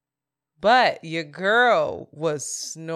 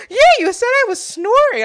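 high, loud mock indignant recall; eager recollection; 10 dB louder than usual. Imitating a friend recalling last night, loud and high. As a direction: imitate someone piping up, sure they remember.

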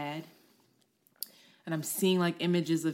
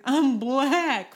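higher, louder blurted cry; child's blurted confession; 9 semitones higher, 7 dB louder than usual. Retold or imitated. Little girl blurting it out to her teacher, high and loud. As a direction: finally blurt it out.

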